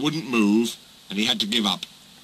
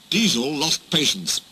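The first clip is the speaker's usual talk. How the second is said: usual speaking voice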